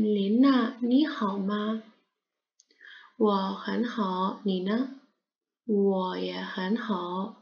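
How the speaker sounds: every syllable stretched out; slow read-through. Shown as a demonstration. Teacher reads the dialogue again slowly.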